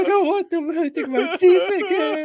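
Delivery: very high-pitched, loud mock wailing; mock victim wail. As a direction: squeal it like a hammed-up crybaby. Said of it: "they was like".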